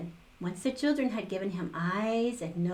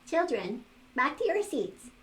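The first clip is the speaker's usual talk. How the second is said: calling teacher; 7 semitones higher than usual. A narrator's reading. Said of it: "called the teacher".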